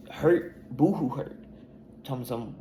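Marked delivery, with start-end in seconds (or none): 0.1-1.4 loud mock crying tone; mock boohoo. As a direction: sneer it like fake tears. Mocking family's reaction.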